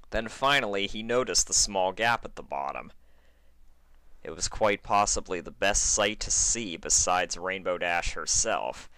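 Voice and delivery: plain reading voice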